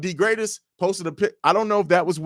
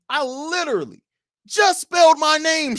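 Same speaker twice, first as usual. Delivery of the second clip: exasperated; 12 semitones higher, 4 dB louder than usual. Real, not acted. Host exasperated at misspelling.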